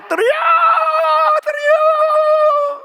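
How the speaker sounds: long, very high imitated scream; hammed-up screaming. Preacher imitating Baal's prophets, a drawn-out screaming cry. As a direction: scream it out, stretched and loud.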